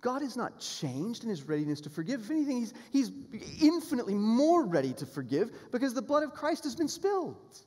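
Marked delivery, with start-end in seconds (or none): 2.2-7.6 high, loud climactic preaching; raised voice at full preaching force; fervent preaching. Real, not acted. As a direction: lift it up with conviction.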